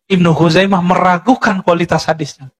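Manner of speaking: normal talking voice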